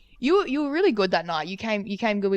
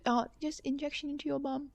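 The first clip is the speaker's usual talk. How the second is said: gentle correction; 6 semitones higher, 9 dB quieter than usual. Retold or imitated. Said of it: "He said".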